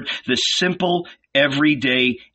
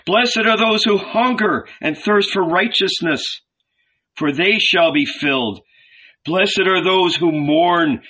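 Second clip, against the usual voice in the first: preaching; 6 semitones higher than usual. A narrator's reading. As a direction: proclaim blessings to the crowd. Jesus preaching.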